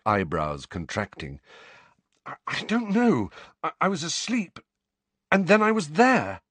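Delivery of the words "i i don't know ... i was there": high, stammering urgency; flustered distress. Acted. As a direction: stumble through an anxious explanation. Harry struggles to explain, distressed.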